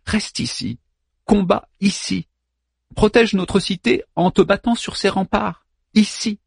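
wife begging Hector not to go back to battle, pleading; Andromaque pleading. Acted. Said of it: "j'ai su qu'elle disait".

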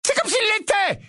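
high, fierce retort. A narrator's reading. Mother snaps back at Sirius over Harry, fierce and high. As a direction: bite back, fiercely protective. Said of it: "d'un ton féroce".

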